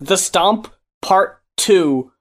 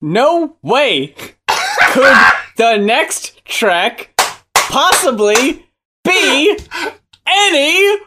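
theatrical disbelief; 8 semitones higher, much slower than usual. Acted. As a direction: pause between phrases, climbing to outrage. Acted listener's mounting disbelief.

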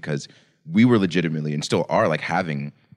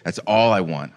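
ordinary delivery

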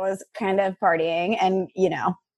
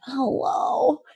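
a mock drowsy hello, pitched up; fake sleepy hello; 6 semitones higher than usual. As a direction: act as if just woken by the call. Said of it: "pretend I'm asleep".

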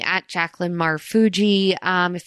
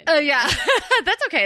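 laughing; 9 semitones higher, faster than usual. Real, not acted. Laughing after the reading.

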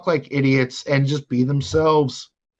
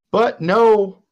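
raised voice; 6 semitones higher, 4 dB louder than usual. Real, not acted. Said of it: "I'm not gonna yell".

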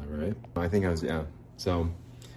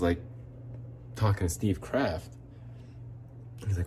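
normal talking voice

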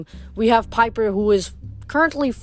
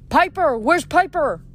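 higher, urgent searching call; worried calling out; 6 semitones higher than usual. A narrator's reading. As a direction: call through a building for someone lost. Jason calling out for missing Piper.

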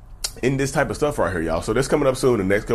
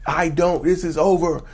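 lightly raised, higher-pitched mock yell; 4 semitones higher than usual. Speaker voicing the partner's actions to listeners, mock yell. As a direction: act out yelling without going full volume. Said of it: "actions are literally yelling at you".

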